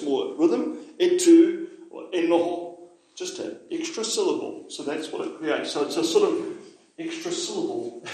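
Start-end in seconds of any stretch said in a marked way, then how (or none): 1.0-1.7 louder "E tū," with a clear lilt on the added e; "E tū," two beats, e then tū; rhythmic e tū. Shown as a demonstration. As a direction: let the rhythm of "E tū," ring out. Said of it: "you can hear how that gives it more cadence".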